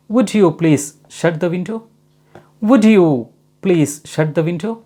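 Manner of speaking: slow polite request. Shown as a demonstration. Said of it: "polite request".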